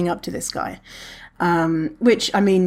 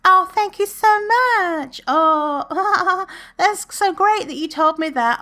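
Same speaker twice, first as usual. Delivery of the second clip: much higher, slow, sugary mock thanks; mock grateful gushing; 12 semitones higher, slower than usual. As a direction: parody the grateful reply he expected. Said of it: "they're probably gonna be like".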